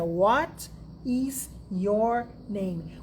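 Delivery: "What is your name?" very slow, each word apart. Shown as a demonstration. Teacher mocks students translating each word slowly. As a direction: spell it out word by word. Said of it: "Você fica pensando em cada uma delas".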